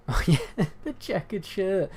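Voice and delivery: high, laughing realization; amused laughing. Real, not acted. Amused by remark on his shirt.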